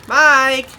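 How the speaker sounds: loud call across the schoolyard; rising loud hail to a friend. Acted. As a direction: shout the name to get him to turn round. Calling friend from afar.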